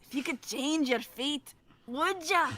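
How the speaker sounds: slow, put-on Scottish trailer line; hammed-up Scottish burr, rising high on "would ya"; Scottish accent. Friend quotes the Brave trailer, in a Scottish accent. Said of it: "But with the accent".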